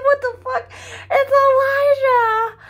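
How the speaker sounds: high, loud, slow laughing cry of disbelief; laughing shriek. Retold or imitated.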